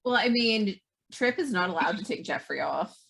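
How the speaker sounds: normal talking voice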